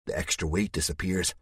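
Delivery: ordinary delivery, nothing marked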